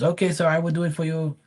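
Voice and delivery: polite pool guy voice. Retold or imitated. Pool guy agreeing to the homeowner's pump request, high and polite. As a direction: mimic the pool guy promising to help. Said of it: "He goes".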